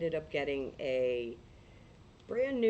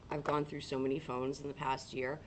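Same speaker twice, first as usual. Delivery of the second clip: ordinary delivery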